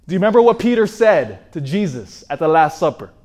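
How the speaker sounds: ordinary talk, nothing marked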